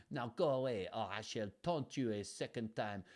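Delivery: mock outrageous French taunt; Monty Python French accent. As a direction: ham up a silly French guard. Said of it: "That is the accent that I'm channeling".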